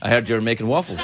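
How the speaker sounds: low, understated mimicked voice; deadpan friend. Drew at your door after waffles, low deadpan imitation. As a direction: drop low, as the uninvited guest.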